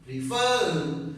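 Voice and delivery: "refer" with a stronger last syllable; stress on second syllable. Shown as a demonstration. Teacher repeating the word for students, "refer" stressed on its last syllable. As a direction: punch the "fer" in "refer". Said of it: "So the last syllable".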